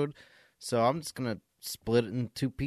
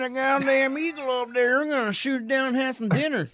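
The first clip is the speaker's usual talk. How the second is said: mock Southern accent; 14 semitones higher, 6 dB louder than usual. Host mocking Southern eagle hunters in a Southern accent. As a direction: play a trigger-happy Southern hunter in a Southern accent. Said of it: "I can't do the accent".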